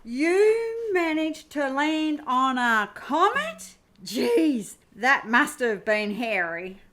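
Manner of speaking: slow, drawn-out disbelief; incredulous. Acted. Crew member to Annette on the comet landing, incredulous. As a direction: stretch the words into an amazed question.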